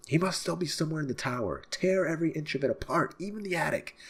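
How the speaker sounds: high, urgent order voice; rally the men to search; commanding guard. Acted.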